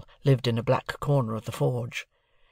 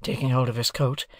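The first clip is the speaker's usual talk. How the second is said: ordinary delivery, nothing marked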